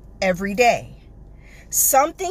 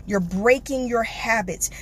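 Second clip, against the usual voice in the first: ordinary delivery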